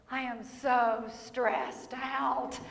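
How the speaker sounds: slow, soft, drooping complaint; whiny stressed-out sigh. Retold or imitated. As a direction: moan it like you're worn out.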